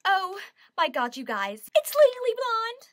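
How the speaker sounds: high, loud, over-the-top fan announcement; gushing, drawn-out opening then high shout; excited squeal. Pretend. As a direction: shriek the show title with delight. Excited announcing of favourite musical.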